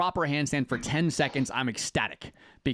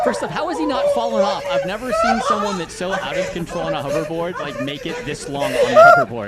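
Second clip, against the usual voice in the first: much higher and louder, laughing through the words; cracking up in disbelief; 8 semitones higher, 9 dB louder than usual. Real, not acted. Reacting to a funny clip.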